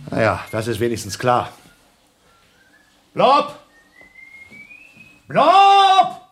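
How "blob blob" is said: calling out. Acted. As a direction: shout the name high and loud. Calling his friend from afar. (laut nach dem Freund rufen)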